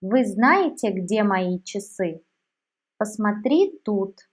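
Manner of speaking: usual speaking voice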